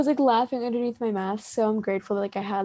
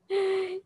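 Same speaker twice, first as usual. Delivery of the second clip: sharp gasp; 9 semitones higher than usual. Real, not acted. Reacting to the friend's message.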